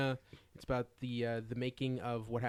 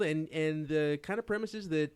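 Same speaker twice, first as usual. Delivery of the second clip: usual speaking voice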